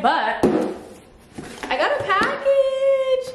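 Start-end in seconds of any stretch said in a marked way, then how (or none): 1.7-3.3 high drawn-out happy squeal; excited squeal. Real, not acted. Vlogger announcing a package to viewers, excited squeal. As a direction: sing out the news, pitched way up. Excited about a surprise delivery.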